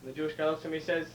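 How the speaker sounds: usual speaking voice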